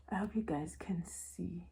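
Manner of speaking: soft unvoiced aside; whispered aside. Real, not acted. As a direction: whisper it to viewers. Aside while showing the book.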